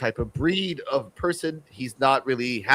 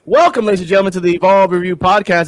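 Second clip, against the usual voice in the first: announcer voice; 3 semitones higher, 5 dB louder, faster than usual. Acted. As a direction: open the show like an announcer. Host announcer welcome.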